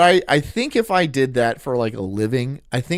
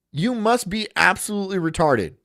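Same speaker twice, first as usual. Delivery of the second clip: normal talking voice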